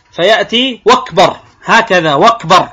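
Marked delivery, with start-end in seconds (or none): 0.9-1.4 "وكبر" said "wakbar", w replacing the opening glottal stop; hamza turned to waw. Shown as a demonstration. Teacher showing a mispronounced takbir, "وكبر" as "wakbar". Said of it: "يبدل همزة أكبر واوا". 2.1-2.7 "وكبر" said "wakbar", w replacing the opening glottal stop; hamza turned to waw. Shown as a demonstration. Teacher showing a mispronounced takbir, "وكبر" as "wakbar". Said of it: "يبدل الهمزة واوا".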